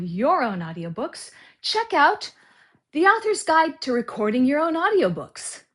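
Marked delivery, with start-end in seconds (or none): 1.6-5.6 ad read. Acted. Narrator pitching his audiobook guide, loud ad read. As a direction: sell the book with energy.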